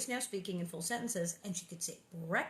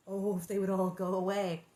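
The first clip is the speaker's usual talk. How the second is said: ordinary delivery, nothing marked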